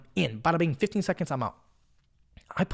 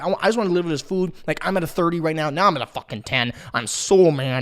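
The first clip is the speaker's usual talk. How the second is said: usual speaking voice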